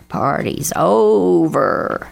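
very drawn-out caw, swelling on OVER; cawing crow. A narrator's reading. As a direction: caw it slowly, stretching OVER even longer. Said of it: "cawed Bernie Crow".

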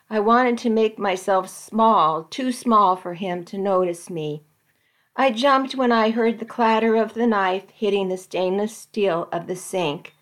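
usual speaking voice

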